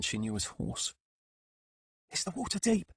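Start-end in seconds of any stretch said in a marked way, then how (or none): 2.1-2.9 put-on child whisper. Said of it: "whispered Legs".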